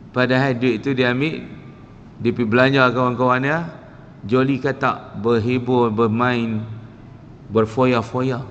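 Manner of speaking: normal talking voice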